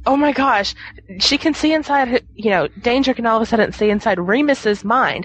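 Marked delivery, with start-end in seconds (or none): 0.1-2.1 mock excited gasp. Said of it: "It's like".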